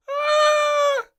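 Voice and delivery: very high, piercing scream; shriek. Acted. Frightened Princess screaming for parents, piercing shriek. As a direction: shriek as loud as you can. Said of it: "shrieked for her parents as loudly as she could".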